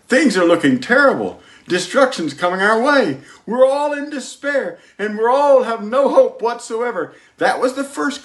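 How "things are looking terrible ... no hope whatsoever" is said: higher, climbing into a wail of despair; mock despairing cry, pitch rising high; despairing confession. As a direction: wail it out like a man without hope. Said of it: "This was the first confession".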